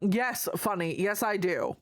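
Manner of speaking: normal talking voice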